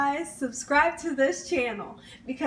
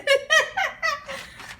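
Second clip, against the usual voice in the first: high burst of excited laughter; giddy laugh; 12 semitones higher than usual. Real, not acted. Cook laughing with excitement over fry bread.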